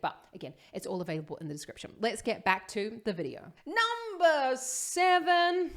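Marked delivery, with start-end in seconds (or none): 3.6-5.7 high, slow, drawn-out announcer call; hammed-up high announcement, stretching each word; playful countdown announcement. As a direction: call it out slowly like a mock announcer.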